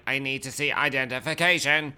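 imitated machine voice demanding papers; robot. Robot demanding ID from the buff guy, imitated robot voice. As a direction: put on a stiff robot voice.

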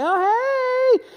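sudden very high, sugary "Oh, hey!" put on for the teacher; fake friendly greeting. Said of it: "and he's like".

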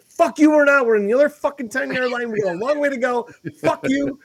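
high, loud frustrated rant; angry yelling. Retold or imitated. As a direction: re-enact yelling down someone's reassurance. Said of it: "and I'm yelling at him".

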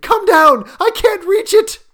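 high, loud, desperate call; frantic high-pitched shout; cried loudly. A narrator's reading. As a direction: yell for help from downstairs. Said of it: "she cried loudly".

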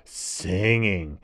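plain reading voice

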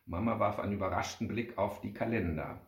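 plain reading voice

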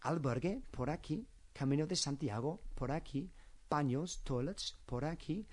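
each short phrase lifts at the end on "aquí"; repeated question upswing on "aquí"; rising question tune. Shown as a demonstration. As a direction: list sample questions, pitch climbing on each "aquí". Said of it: "an inflection at the end of the sentence".

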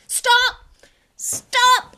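high, loud panicked yelling; yelled. Acted. Ron yelling at the plummeting car, panicked. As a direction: yell at the car to stop. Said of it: "he yelled".

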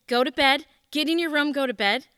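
loud, firm command to go to bed; stern order. Retold or imitated. Father ordering his crying daughter to bed, loud and stern. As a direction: bark it like an angry father. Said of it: "And he just said".

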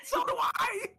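high outraged yelp; indignant shout. Real, not acted. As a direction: shout back, offended. Indignant comeback in banter.

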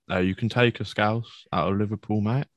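ordinary delivery

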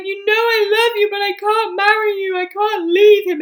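hammed-up tearful outburst; hysterical crying. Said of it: "crying hysterically".

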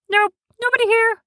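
very high squeaky creature voice; tiny creature in a hole insists nobody's home; hiding creature voice. Acted. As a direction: squeak it out, pretending no one's there. Hidden creature answers again.

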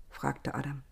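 plain reading voice

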